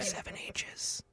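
normal talking voice

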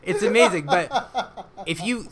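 high, loud laughing words; talking through laughter. Real, not acted. Laughing at Men in Sheds.

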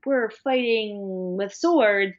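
slow, dumb meathead bro voice; lampooning a reality-show bro, drawn out; mock macho bro. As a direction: play a slow-witted gladiator bragging. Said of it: "the men are doing stuff like".

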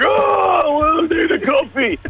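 high, groggy mock wake-up groan; groggy morning voice. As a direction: whine it like a just-woken friend. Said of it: "It's like".

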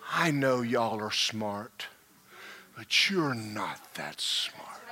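slow, soft, breathy aside; quiet teasing whisper; whispered jab. Real, not acted. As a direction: whisper the dig to the crowd.

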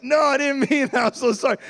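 high, flustered backpedalling; embarrassed apology. Retold or imitated. Awkward evangelist apologising to the store clerk, flustered. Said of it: "So he's now embarrassed".

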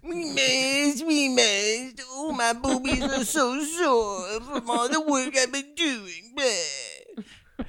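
put-on high, breathy chanting girl; high mock girl voice. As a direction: squeal it as a teen girl. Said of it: "Okay, rolling".

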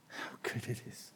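very soft, almost whispered reflection; hushed wonder. Real, not acted. Preacher dwelling on the words just sung, hushed wonder. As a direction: let it sink in, barely voiced. Awed repeat of the song's line.

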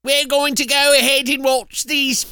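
high put-on British voice; British accent. Host telling listeners what they will watch, British accent. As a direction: announce it in mock British. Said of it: "With your British accent".

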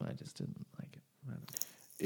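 whispered repeat. Real, not acted. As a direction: drop to a whisper. Repeats line, then whispers.

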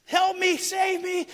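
high mock scream for help; panicked cry for help. Drowning boy calling to his dad, high panicked cry. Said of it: "I started panicking and screaming".

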